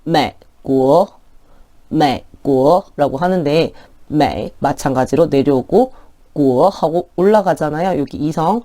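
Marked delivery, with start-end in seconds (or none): 0.0-3.2 매고 slow, 매 low then a silent gap before rising 고; 반삼성 매고; half third tone. Shown as a demonstration. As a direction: drop 매 and wait before lifting 고. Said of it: "두 번째 거 보실게요".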